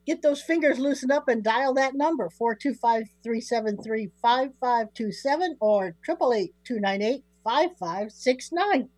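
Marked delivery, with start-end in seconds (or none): none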